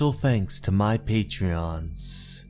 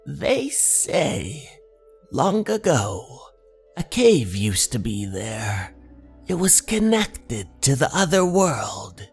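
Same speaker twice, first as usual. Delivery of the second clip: mysterious local stranger; 5 semitones higher than usual. Acted. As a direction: tell the local legend with eerie, lifted intonation. Stranger goes on telling local legend.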